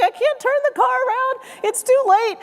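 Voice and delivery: high-pitched, strained mock protest; exasperated dad yelling back. Dad to yelling grandmother on narrow road, mock yelling. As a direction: imitate a flustered dad hollering back.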